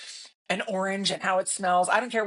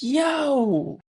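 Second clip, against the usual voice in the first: higher, drawn-out retold shock; taken aback; 7 semitones higher, 4 dB louder than usual. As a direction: react aghast, pitch jumping up. Stunned parent's retold reaction.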